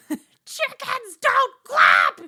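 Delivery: very high, drawn-out scream; Buster screaming. Acted. Showing how Buster's line would terrify guests, screamed. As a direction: bellow it slowly at full volume. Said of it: "like, screamed at you".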